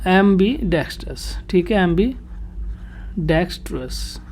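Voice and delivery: ambidextrous slowly split as ambi, dextrous; word in parts. Shown as a demonstration. Teacher splits the corrected word. (ambidextrous को धीरे-धीरे तोड़कर)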